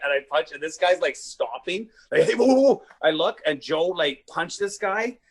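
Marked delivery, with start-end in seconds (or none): 2.2-2.8 high, loud imitation of backing off; mock alarmed protest. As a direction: act out urgently calling a halt. Acts out guy backing off.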